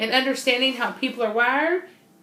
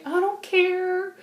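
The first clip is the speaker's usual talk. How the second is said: drawn-out indifference; 10 semitones higher, slower than usual. Retold or imitated. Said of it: "If I say".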